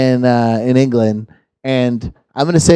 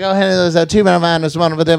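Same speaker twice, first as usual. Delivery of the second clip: raised, rattled-off auctioneer patter; 3 semitones higher than usual. Retold or imitated. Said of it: "a fucking auctioneer voice and a super redneck".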